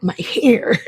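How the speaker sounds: flustered, deflecting the compliment; fussing over hair. Acted. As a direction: fuss about your hair. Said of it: "You ain't gotta stop and be like".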